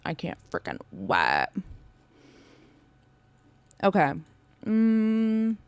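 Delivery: talking while chewing; words around a mouthful of food; mouth full. Real, not acted. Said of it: "Sorry, I'm chewing in your ears".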